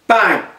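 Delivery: loud, high shouted bang; vocal gunshot, sharp and loud. Acted. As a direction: punch it out like a gunshot. Gunshot in kneecapping scene.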